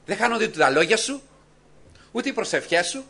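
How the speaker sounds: ordinary talk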